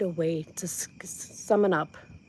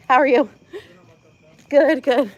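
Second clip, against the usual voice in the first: ordinary delivery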